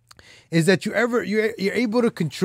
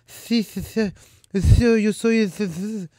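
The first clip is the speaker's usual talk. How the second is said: a light, higher crooning Spanish-singer voice; 3 semitones higher, slower than usual. Retold or imitated. To viewers, mocking a suave Spanish singer's voice, lightly done. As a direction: lightly mimic a suave Latin pop star, pitched up. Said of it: "He, he speaks like this".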